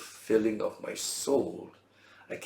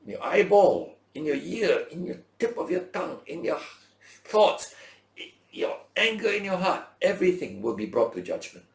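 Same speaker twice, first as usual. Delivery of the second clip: high, loud, rising declamation of scripture; preaching at full force; 8 semitones higher, 5 dB louder than usual. Acted. Preacher proclaiming judgment to his congregation, full force. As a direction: thunder each line at the congregation. Reading Ecclesiastes' closing verses aloud.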